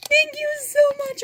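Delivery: high, thrilled thank you; overjoyed thanks. Real, not acted. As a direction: gush your thanks. Thanking friend for gift.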